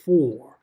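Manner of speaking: "four" set apart and said loud; emphasis on four. Real, not acted. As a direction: punch the word "four" on its own after a pause. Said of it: "I want to emphasize the word".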